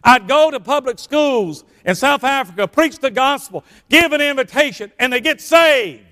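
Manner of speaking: high, emphatic pulpit cry; full-force preaching. Real, not acted. Preacher exhorting church about preaching in public schools, full-force preaching. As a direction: preach it hard, stretching the last phrase.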